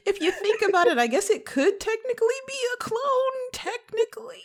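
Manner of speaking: very high, squeaky mock reasoning; teasing concession. Co-host teasing over the clone theory, squeaky mock concession. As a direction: squeak out a playful quibble.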